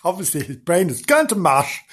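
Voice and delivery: plain reading voice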